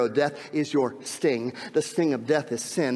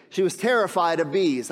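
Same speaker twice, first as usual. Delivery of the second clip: normal talking voice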